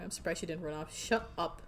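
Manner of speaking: ordinary talk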